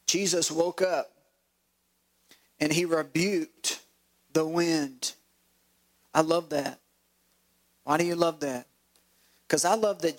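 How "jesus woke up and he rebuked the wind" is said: slow reading with long pauses; measured, savoured scripture reading; deliberate rereading. Shown as a demonstration. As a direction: read it slowly, pausing before REBUKED and THE WIND. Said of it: "I'm gonna read that one again".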